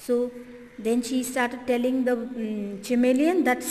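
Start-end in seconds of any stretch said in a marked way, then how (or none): none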